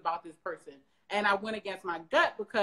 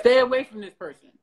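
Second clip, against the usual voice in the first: louder, punched repeat of the warning; emphatic repeat; 2 semitones higher, 9 dB louder than usual. Retold or imitated. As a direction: hammer the warning home the second time. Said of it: "something about this individual said to me".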